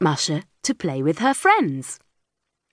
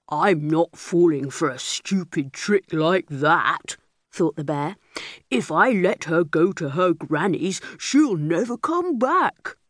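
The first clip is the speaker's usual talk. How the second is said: bossy bear; 4 semitones lower than usual. A narrator's reading. Big bear tells captured girl to work for him. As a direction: growl orders at the little girl.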